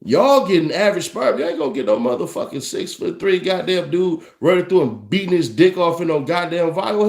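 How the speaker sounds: usual speaking voice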